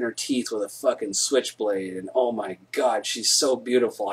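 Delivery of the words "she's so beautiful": ordinary talk, nothing marked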